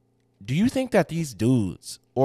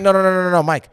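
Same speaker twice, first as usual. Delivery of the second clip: louder, rapid no's; loud protest; 6 semitones higher, 8 dB louder than usual. Real, not acted. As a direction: shut the idea down. Refusing to cut the part.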